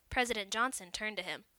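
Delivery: ordinary delivery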